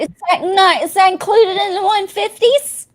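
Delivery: high-pitched mock-innocent questioning; mocking questions. Mockingly questioning the salesman's vanished accent.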